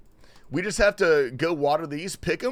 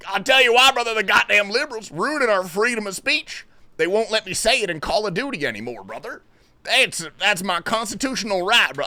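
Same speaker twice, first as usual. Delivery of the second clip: louder, higher hammed-up freedom-rant voice; mock angry patriot; 5 semitones higher, 5 dB louder than usual. Streamer mocks an outraged anti-liberal gamer to chat, hammed-up rant. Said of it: "I'm just kidding".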